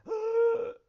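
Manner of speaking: loud, very high acted gasp; sudden shocked gasp, hammed up for a story; heart-attack gasp. Storyteller acts out surprised husband's heart attack, loud gasp. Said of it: "he has a heart attack".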